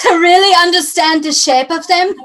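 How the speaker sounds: put-on high, loud made-up foreign accent, almost French; hammed-up unplaceable European accent; vague foreign accent. Cohost jokes as the lecherous sculptor, in a hammy foreign accent. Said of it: "I don't know what accent that was".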